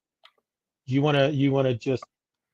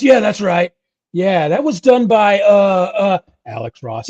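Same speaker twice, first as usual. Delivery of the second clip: mock fan; 7 semitones higher, 9 dB louder, slower than usual. Fan confirming the artist's name.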